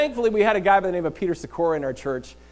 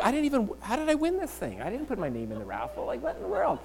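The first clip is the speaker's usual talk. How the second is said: mildly incredulous, pitched up at start and end; baffled disbelief; 8 semitones higher, 6 dB quieter than usual. Acted. Winner who never entered the raffle, baffled disbelief. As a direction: puzzle it out in bewilderment.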